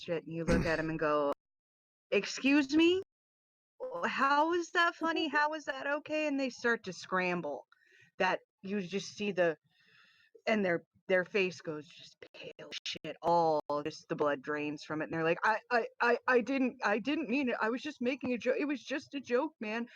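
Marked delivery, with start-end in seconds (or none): 2.1-6.3 high, sharp "excuse me?" disbelief; indignant challenge. Retold or imitated. Confronting a racist joke teller. 15.4-19.9 higher, stumbling panicked excuse; flustered stammering. Retold or imitated. Racist scrambling after being caught.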